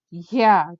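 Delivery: triumphant yell. A narrator's reading. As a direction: burst out with a yell as you grab him. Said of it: "Jerry yelled".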